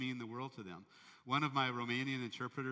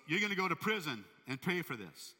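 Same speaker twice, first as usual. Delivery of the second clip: angry sentence; 6 semitones higher, 5 dB louder than usual. Retold or imitated. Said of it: "he goes".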